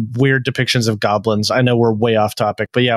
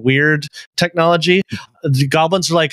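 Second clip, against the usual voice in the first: usual speaking voice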